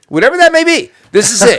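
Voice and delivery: very high, loud comeback; squawking, emphatic insistence; loud insistent retort. Real, not acted. Shouts the aside back, insisting.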